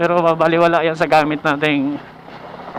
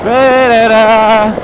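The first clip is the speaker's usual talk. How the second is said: slow drawn-out word; 5 dB louder, much slower than usual. Real, not acted. Rider drawls word while riding.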